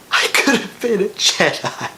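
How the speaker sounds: mock tearful, hushed plea; half-hearted whimper; fighting back tears. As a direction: choke it out like a hurt kid. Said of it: "I'm fighting back tears".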